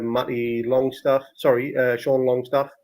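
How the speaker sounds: usual speaking voice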